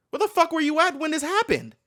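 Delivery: high, loud indignant demand; indignant outburst. Pretend. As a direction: call out the police, loud and high. Outburst at the police in the video.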